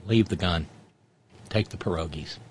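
low, soft mobster mutter; lightly voiced mob boss. Mob movie quote parody.

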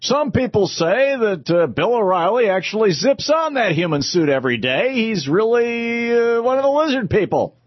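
hammed-up newsman parody; mock pundit insinuation. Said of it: "this is the official catchphrase".

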